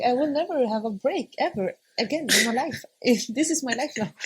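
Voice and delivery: a mock wail about never resting again; despairing new mom. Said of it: "it was like".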